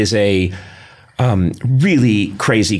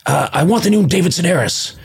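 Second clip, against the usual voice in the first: higher, odd put-on voice for an insistent customer; 9 semitones higher than usual. Host mimics a bookstore customer asking for the new book in a higher put-on voice. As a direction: demand it like a strange, fussy shopper. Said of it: "this person comes in and says".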